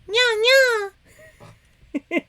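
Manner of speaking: high, squeaky catgirl nya; cutesy nya speak. Pretend. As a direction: chirp it out cute and high. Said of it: "Just for you".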